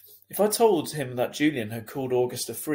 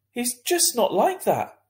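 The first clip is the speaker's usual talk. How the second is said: high, louder protesting mum; defensive mum; 12 semitones higher, 5 dB louder, slower than usual. A narrator's reading. Mother defending son to principal, high and pleading. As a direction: insist he's not like that.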